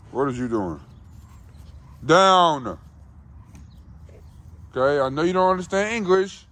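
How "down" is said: loud, high sharp dog command; firm command. Real, not acted. Owner ordering the dog down, loud and firm.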